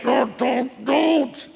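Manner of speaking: high, strained don't; mock manly refusal. Man resisting tears, high strained refusal. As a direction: fight back the tears in a high voice. Men fighting back tears.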